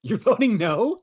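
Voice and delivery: can't believe what she just heard; surprised. Real, not acted. Guest hearing host voted no, loud and incredulous. Guest shocked at host's vote.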